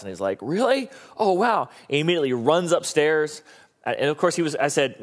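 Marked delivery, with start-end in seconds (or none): 0.4-1.7 put-on high, thrilled little kid; excited child. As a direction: squeal it like a delighted toddler. Excited toddler hears sleepover.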